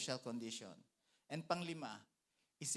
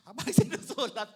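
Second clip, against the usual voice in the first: laughing; 8 semitones higher, 14 dB louder than usual. Real, not acted. Laughing while retelling.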